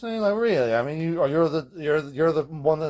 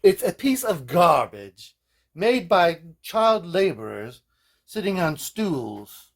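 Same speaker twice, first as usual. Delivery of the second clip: snobby forum poster; 7 semitones higher, 5 dB louder, much slower than usual. Retold or imitated. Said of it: "resident douchebags".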